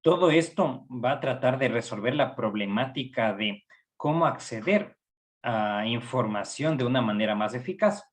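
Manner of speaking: ordinary talk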